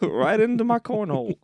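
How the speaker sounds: high squeaky leprechaun. Acted. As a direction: pitch up into a cheeky little imp. Listener joins leprechaun bit.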